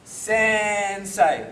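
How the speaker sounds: Sensei with a long stretched Sen, quick sei; slow first syllable. Shown as a demonstration. Teacher showing uneven speed, slow first syllable. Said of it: "people start off the mark slow".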